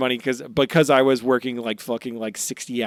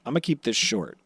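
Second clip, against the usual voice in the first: usual speaking voice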